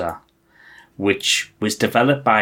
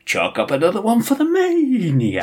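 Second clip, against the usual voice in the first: high, cocky pilot shout; Maniac's battle cry; 11 semitones higher than usual. Retold or imitated. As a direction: yell it like a hotshot pilot. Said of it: "he'd shout".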